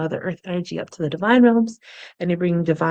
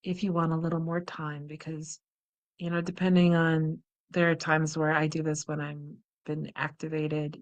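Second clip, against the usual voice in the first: guided meditation; 6 dB quieter than usual. Acted. Guided relaxation practice.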